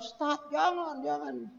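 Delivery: high, soft, drawn-out plea; imitated pleading bystanders. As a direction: mimic onlookers gently urging him to stop. Retold bystanders urging him not to.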